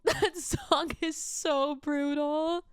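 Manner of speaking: real laughing tears; tearful crying. Real, not acted. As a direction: say "so brutal" through tears. Same words, tearful.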